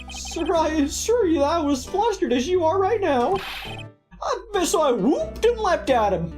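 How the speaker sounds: high, earnest rookie cop voice; eager young patrolman. Acted. Voices patrolman character in game.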